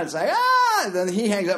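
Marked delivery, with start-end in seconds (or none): 0.3-0.8 very high, loud "aah"; mock panicked scream. Drunk man reacting to sponsor's call, mock panicked scream. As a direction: shriek in comic panic.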